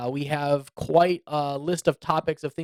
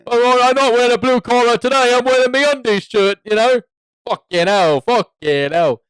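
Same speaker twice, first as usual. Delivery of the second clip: loud, hammed-up British working-class rant; mock British accent; 9 semitones higher, 11 dB louder than usual. Host doing the British co-host's class rant, loud mock British accent. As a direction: rail like an aggrieved Brit, pretend fury. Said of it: "that's supposed to be what you're saying".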